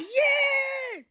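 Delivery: high delighted cry of relief at finding others; excited shout. Retold or imitated. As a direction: cry out like you finally found home. Joy meeting fellow unbelievers.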